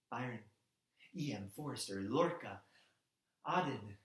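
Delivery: low, measured recital with pauses; solemn roll call. Acted. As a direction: set down each name quietly and separately.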